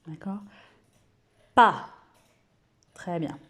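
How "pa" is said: Pa said loud and clear; teacher modelling a syllable; loud syllable demo. Shown as a demonstration. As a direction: call out Pa. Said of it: "Vous redites avec moi".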